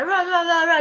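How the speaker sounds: retold yell, half-strength; imitated garbled yell. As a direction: imitate someone yelling nonsense at you. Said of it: "no sé qué me grita".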